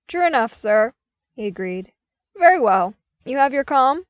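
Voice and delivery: loud clipped confirmation; firm commander. A narrator's reading. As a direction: confirm it firmly.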